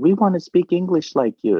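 lightly mocked eager fans; pleading fans. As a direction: beg to sound like him. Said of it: "I get into that".

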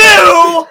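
sudden high loud laugh; a real, loud laugh bursting out; cracking up loudly; loud burst of laughter. Real, not acted.

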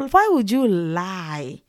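slow, drawn-out why would you lie; a slow, weary challenge; slow pointed question. Real, not acted. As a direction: stretch it out, accusing.

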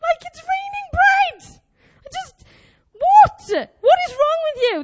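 high, drawn-out incredulous outcry; exasperated disbelief. Acted. Preacher scolding the moaning Israelites over manna, exasperated. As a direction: exclaim it high, appalled at their moaning.

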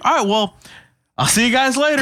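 lightly voiced departing guy; high mock stranger voice. Retold quote of stranger.

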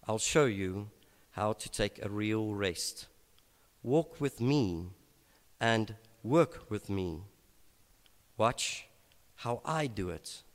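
slow paraphrase read in gentle phrases. Shown as a demonstration. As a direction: linger on each line, let it sink in. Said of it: "I'm also gonna read this slowly".

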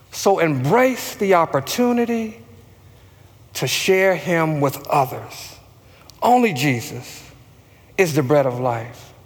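low, subdued preaching with long pauses; hushed-down, measured pulpit delivery; solemn preaching refrain. Real, not acted. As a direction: bring the sermon down low and let each phrase settle.

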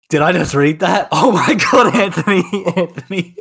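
laughing in disbelief; can't believe what she's reading. Real, not acted.

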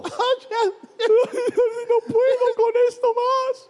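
exaggerated tearful whimper, very slow; mock crying. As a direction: fake a tearful wail through a laugh. Interpreter mimes a crying wife.

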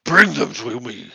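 shaman calls out loudly to Borov. Acted. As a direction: bark the order across the hall. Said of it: "he raises his voice".

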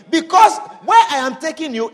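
ordinary talk

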